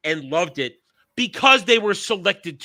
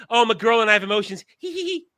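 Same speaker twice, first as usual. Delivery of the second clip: put-on fast high girly chatter ending in giggles; mock girly gush; 5 semitones higher, 3 dB louder, faster than usual. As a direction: play a giddy girl robot. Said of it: "her first thing".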